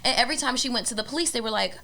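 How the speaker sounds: ordinary talk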